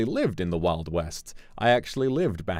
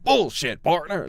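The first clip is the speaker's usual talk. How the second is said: put-on cowboy accent; 10 semitones higher, 6 dB louder, slower than usual. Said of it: "he kept saying".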